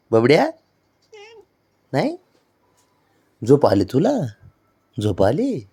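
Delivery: usual speaking voice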